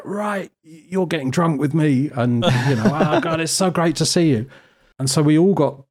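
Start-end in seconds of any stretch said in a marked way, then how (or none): 0.0-2.1 high, drawn-out tipsy bellow at normal volume; a hint of boozy, slow insistence, pitched up. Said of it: "He's thirty-three sheets to the wind". 2.9-4.5 high-pitched tipsy gushing; mock-drunk delight, voice pitched well up. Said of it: "He's thirty-three sheets to the wind".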